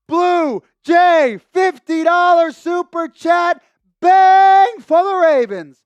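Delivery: big drawn-out celebratory shout, high and loud; cracking hype shout. Real, not acted. Host hyping a fifty dollar super chat, cracking shout. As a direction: belt out the donor shout-out till the voice cracks. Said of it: "Bad voice crack. I'm losing my voice".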